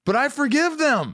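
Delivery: retold release. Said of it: "I just said".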